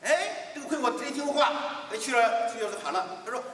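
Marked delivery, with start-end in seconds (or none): none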